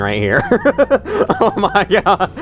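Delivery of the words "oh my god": high, laughing disbelief. Real, not acted. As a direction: squeal it through real laughter. Cracking up at own gameplay.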